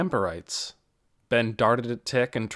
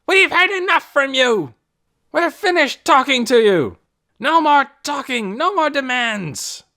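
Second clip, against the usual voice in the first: high, loud enraged screaming; furious scream; 9 dB louder than usual. A narrator's reading. Ben screaming back at Tick, furious. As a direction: shut them down in a rage. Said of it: "Ben just screamed back".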